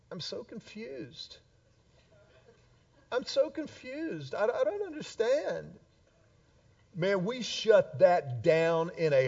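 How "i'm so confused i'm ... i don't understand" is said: soft, higher, bewildered whine of a girl playing dumb; daughter playing confused. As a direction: pretend to be lost and helpless, softly. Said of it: "This is what she would try".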